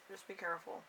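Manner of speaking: usual speaking voice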